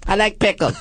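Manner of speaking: pull string toy. Pretend. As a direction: sound like a cheap talking doll. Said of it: "what she says on her doll".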